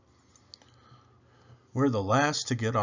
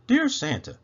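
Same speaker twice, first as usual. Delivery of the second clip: put-on high child voice opening a letter; child's letter; 12 semitones higher, 4 dB louder than usual. A narrator's reading. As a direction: start the letter like a hopeful kid. Child writing letter aloud.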